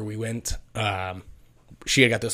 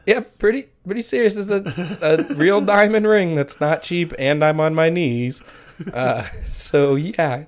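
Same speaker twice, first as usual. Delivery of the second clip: giddy nervous; 6 semitones higher, 5 dB louder than usual. Retold or imitated. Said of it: "I'm like".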